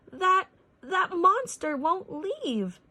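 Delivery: high, slow, frantic shout. A narrator's reading. Darla shouting at the sheriff through the window, panicked. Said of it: "Darla shouted out".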